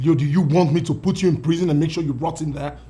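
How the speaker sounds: put-on older brother shouting down his sibling; angry threat. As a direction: threaten him with prison at full volume.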